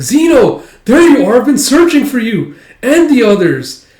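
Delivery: high, loud, excited call; relieved calling. Retold or imitated. Yona finding Zeno after searching, excited. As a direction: call out on finding a lost friend.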